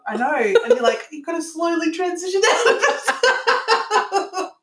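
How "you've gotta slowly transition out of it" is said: high, slow, laughing delivery. Pretend. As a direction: barely get it out through giggles. Joking about slipping into copied accent.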